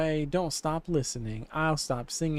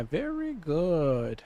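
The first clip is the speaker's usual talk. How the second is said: slow put-on voice; 3 semitones higher, much slower than usual. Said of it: "I don't know why I'm talking like this".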